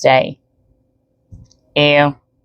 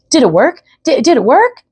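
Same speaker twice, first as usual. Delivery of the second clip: high, eager, asking twice in a rush; an excited boy bouncing on the spot; excited child; 9 semitones higher, 3 dB louder than usual. Acted. Boy asking his family if the blast worked, excited. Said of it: "jumping up and down".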